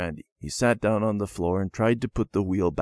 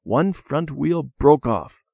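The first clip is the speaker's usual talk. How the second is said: ordinary delivery, nothing marked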